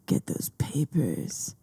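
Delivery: unvoiced, slow creepy whisper. Mocking a creepy camp counselor, whispered. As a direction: hiss it like a shady secret. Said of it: "he's like".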